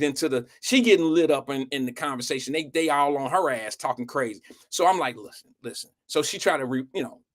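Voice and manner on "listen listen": very soft, low hush; trying to whisper. Retold or imitated. Boy trying to calm a worked-up classmate, hushed and low. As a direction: quietly ask for her attention. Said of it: "I'm trying to whisper".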